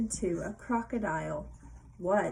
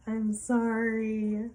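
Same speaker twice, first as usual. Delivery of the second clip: drawn-out apology; 4 dB louder, much slower than usual. Acted. Reading the polite answer.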